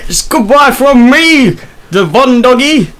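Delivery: mock Cockney. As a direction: stretch out a hammed-up British goodbye. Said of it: "I can't actually do Cockney".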